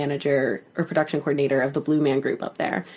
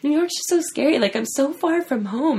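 higher-pitched imitation of an anxious friend; mock homesick worry; 10 semitones higher than usual. Friend fretting about being far from home, mock worried. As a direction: play up the newcomer's fretting.

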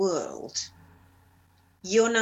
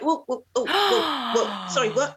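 shocked; 4 semitones higher, 3 dB louder than usual. Retold or imitated. Shocked reaction retold.

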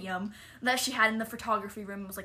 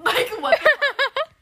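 much higher, loud, slow, cracking up; laughing disbelief; 13 dB louder, slower than usual. Real, not acted. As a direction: squeal it through laughter. Laughing at lip gloss story.

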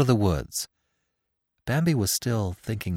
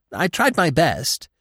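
slow, high-pitched protest; protesting squirrel; 7 semitones higher, 3 dB louder than usual. A narrator's reading. Squirrel insisting he did his part, protesting.

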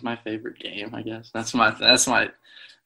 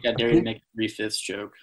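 usual speaking voice

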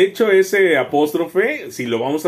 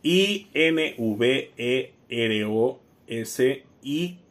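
very slow, measured spelling; slow letter-by-letter dictation; 2 semitones lower, 5 dB quieter, much slower than usual. Shown as a demonstration. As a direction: spell it out one letter at a time. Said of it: "dinos, letra por letra".

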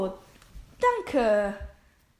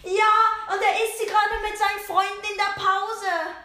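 high telling-on voice; tattletale; 8 semitones higher than usual. Acted. Tattletale telling the teacher about the cookies. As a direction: tattle eagerly. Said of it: "die Petze".

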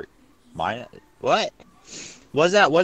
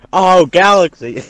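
loud, higher call; 7 semitones higher, 11 dB louder than usual. Real, not acted. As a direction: call out to a friend. Calling out to friend.

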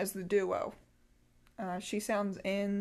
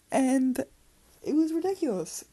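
much louder, higher exasperation; exasperated; 5 semitones higher, 8 dB louder than usual. Real, not acted. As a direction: burst out in frustration. Exasperated at her own meltdown.

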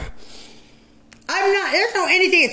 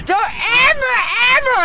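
angry shout; 8 semitones higher, much faster than usual. Real, not acted. Ranting at corrections staff.

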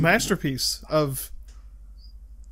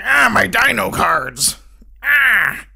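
slow, loud, higher whiny cry; gimmicky dub voice; 5 semitones higher, 7 dB louder, slower than usual. Retold or imitated. Minor Yu-Gi-Oh character mourning his cards, whiny cry. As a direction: wail like a cartoon dub side character. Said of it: "He talks like that the whole time".

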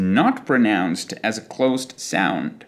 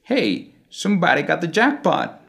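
higher American accent, open ah vowels; American English; 6 semitones higher than usual. Shown as a demonstration. Teacher asking learners to hear the difference, American English accent. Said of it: "In American English".

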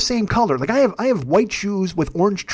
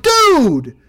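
loud, high startled shout; retold startled yell; 8 semitones higher, 7 dB louder than usual. Man retelling a cat launching at his head, startled yell. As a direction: yell it out in alarm, retelling the scare.

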